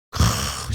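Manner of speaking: a short unvoiced huff through the nose; an irritated snort that says he has had enough; angry snort. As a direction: huff like someone fuming under their breath. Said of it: "씩씩거리고".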